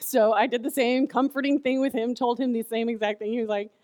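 ordinary talk, nothing marked

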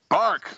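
high yappy bark; a high little dog bark; lady dog voice. Pretend. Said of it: "you don't sound like a lady dog".

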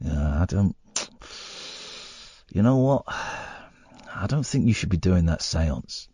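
lightly voiced anxious sensible person, quiet and slow; mock worried warning. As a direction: imitate a nervous friend cautioning him. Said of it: "have come up to me and said".

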